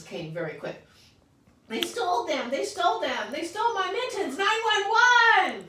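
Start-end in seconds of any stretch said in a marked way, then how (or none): 1.7-5.6 put-on frantic child calling nine one one; hollering. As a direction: holler for help like a siren. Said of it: "I shouted some more".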